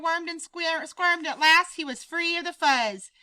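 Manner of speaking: ordinary delivery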